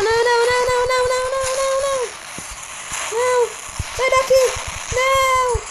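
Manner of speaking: high frantic shouts of no, over and over; real panic as the robot closes in; panicked yelling. Real, not acted. Gamer screaming at the robot attacking him, high and frantic.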